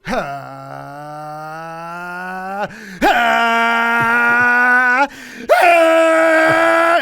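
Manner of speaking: rising from low to high shouting; power up yell. Shown as a demonstration. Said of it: "You gotta start low and go".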